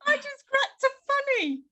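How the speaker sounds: high-pitched laugh through the words; giggling at her own joke. Real, not acted.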